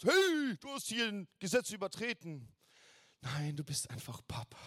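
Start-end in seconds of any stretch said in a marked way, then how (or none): 0.0-2.5 high, loud barked "Hey" like a cop catching you; stern policeman. Retold or imitated. Preacher mimics a policeman scolding a lawbreaker, loud and high. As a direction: snap at them as a strict officer would. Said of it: "oder ein Polizist".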